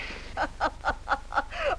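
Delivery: breathy run of laughter; mocking laughter. Woman laughing at her suitor's proposal, mocking. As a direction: laugh right in his face. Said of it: "I'm laughing".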